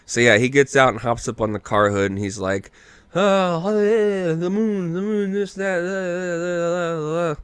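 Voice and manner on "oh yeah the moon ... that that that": rambling comic bit. Retold or imitated. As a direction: mimic endless goofy rambling in a raised pitch. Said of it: "it feels like it goes on forever".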